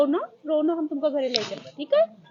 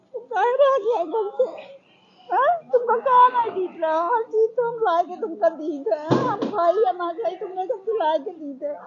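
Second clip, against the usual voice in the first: tearful, pitched-up scolding through sobs; crying scolding; 5 semitones higher, 4 dB louder than usual. Real, not acted. Mother scolding found daughter, crying.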